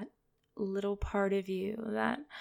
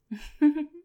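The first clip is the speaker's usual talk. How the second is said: high, loud mocking laugh; teasing laugh; 7 semitones higher, 9 dB louder than usual. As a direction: laugh down at him, amused. Domme laughing at listener.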